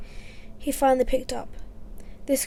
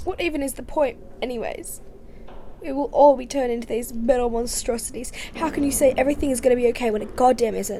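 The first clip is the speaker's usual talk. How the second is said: despairing outburst; 4 dB louder than usual. Acted. Despairing argument.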